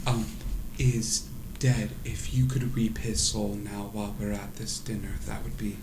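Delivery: soft, quiet noble lady; lightly voiced mother. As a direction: politely ask in a hushed voice. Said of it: "she goes".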